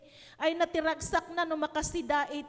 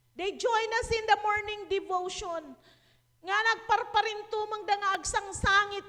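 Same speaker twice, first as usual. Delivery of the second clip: normal talking voice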